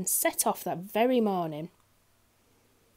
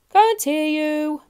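loud, high, slow bellow; booming drawn-out shout; bellowing greengrocer; 7 semitones higher, 10 dB louder, much slower than usual. A narrator's reading. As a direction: holler it, stretching the words. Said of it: "bellowed the greengrocer".

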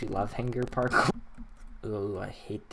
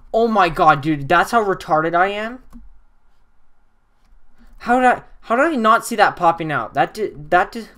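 loud, high, fast self-mocking disbelief; incredulous shout; 6 semitones higher, 9 dB louder than usual. Real, not acted. Gamer shouting at himself over a missed button, loud disbelief. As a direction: yell at himself for missing it.

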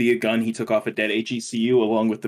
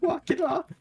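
squeaky high mock; 4 dB quieter than usual. Mocking repeat, high.

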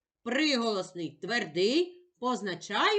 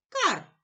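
crow caw; 7 semitones higher than usual. Acted. Crow's caw in poem.

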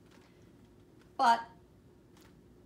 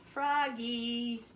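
loud, higher, drawn-out name call; 6 semitones higher, 5 dB louder than usual. A narrator's reading. Mom calls Froggy, drawn-out loud call. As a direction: call the child from another room. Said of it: "called his mom".

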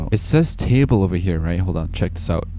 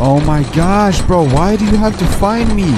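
loud, much higher panicked cries; panicked yelling; 10 semitones higher, 8 dB louder than usual. Real, not acted. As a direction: yell out in panic. Caught by the neighbor.

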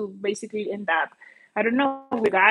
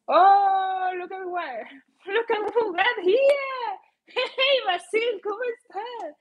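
high, bright hello with laughs; light up to welcome a friend back; delighted greeting; 8 semitones higher than usual. Real, not acted. Streamer happily greets Basil who just joined, delighted.

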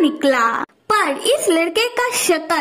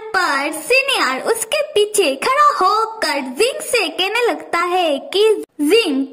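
plain reading voice

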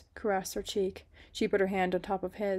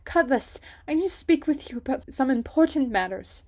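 higher, louder nervous plea; stammering; 6 semitones higher, 8 dB louder than usual. Acted. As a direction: force it out, flustered. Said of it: "she managed to stammer out".

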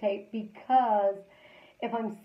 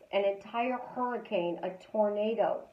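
ordinary talk, nothing marked

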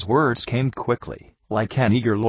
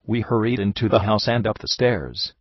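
ordinary delivery, nothing marked

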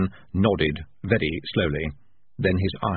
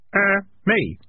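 put-on high boy's voice; timid boy, pitched high; kid voice; 12 semitones higher, 3 dB louder than usual. A narrator's reading. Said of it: "said the boy".

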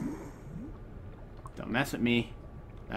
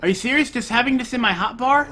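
usual speaking voice

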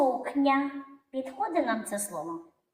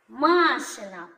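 "Машина" slowly as МА-шина; stress on first syllable; 3 dB louder, slower than usual. Shown as a demonstration. Teacher answers whose car it is, stressed "МАшина". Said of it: "Пошукаємо наголос у слові".

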